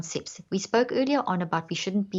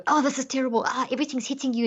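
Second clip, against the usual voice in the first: higher, fast, flustered cry of overwhelm; mock overwhelmed; 6 semitones higher, faster than usual. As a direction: sound swamped by it all. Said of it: "That's what we're doing when we go".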